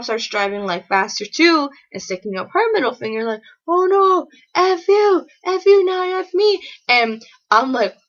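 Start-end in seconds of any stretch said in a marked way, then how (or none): 3.7-6.6 high, loud mock cursing back; indignant mom. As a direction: imitate a mother firing insults back. Mom swears back at driver.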